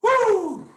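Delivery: a high, loud whoop of fired-up excitement; excited whoop. Real, not acted. Caller host letting out a whoop at his own rant, fired-up whoop. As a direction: let out a sudden high whoop. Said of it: "Let me, let me calm down a little bit".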